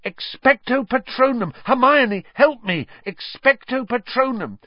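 high, panicked spell-casting; desperate cry. A narrator's reading. Harry to Hermione under Dementor attack, crying desperately. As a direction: cry out urgently for help. Harry casts and begs for help.

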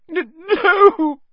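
loud, very high stuttered wail; sobbing. A narrator's reading. Lavender answering about her dead rabbit, sobbing. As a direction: sob it out, stumbling on the word. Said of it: "sobbed Lavender".

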